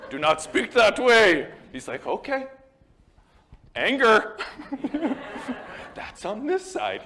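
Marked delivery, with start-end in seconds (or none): 0.1-1.5 loud, high mock outrage; hammed-up indignant order; mock scolding. 2.1-7.0 high-pitched mock counselor; exaggerated high put-on voice; hammed-up counselor. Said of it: "I'm hamming it up a bit".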